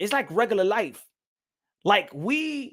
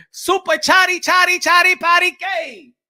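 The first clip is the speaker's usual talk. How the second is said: playful singsong; 7 semitones higher, 7 dB louder than usual. Real, not acted. Playful rhyme to donor.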